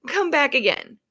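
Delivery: high, loud, slow fake-polite brush-off; mock clinic staff. Mimicking staff sending a sick patient home, sing-song and slow. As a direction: play a chirpy receptionist turning her away. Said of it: "get to Seattle and say".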